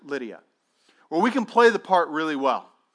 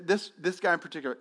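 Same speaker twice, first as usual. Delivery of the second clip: ordinary talk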